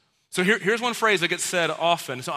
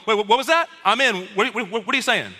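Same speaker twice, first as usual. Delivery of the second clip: eager perk-up; 4 semitones higher, 3 dB louder than usual. Pretend. Disciples perk up at power.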